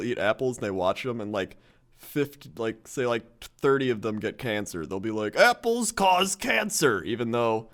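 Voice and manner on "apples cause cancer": high, loud, slow announcement; mock proclamation. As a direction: declare it like a foolish scientist. Mock researcher conclusion.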